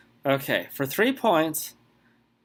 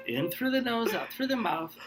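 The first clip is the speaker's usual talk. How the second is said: softer soothing guidance; calming breath coaching; 7 semitones higher, 6 dB quieter than usual. Shown as a demonstration. Host keeps calming the contestant with breathing, soft and soothing. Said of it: "take a breath".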